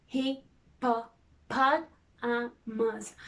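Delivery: hippopotamus stretched very slowly, syllable by syllable; hand-tip syllables. Shown as a demonstration. Teacher tapping syllables for the class, very slowly. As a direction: drag out every part of hippopotamus. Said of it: "Now let's do the hand tip".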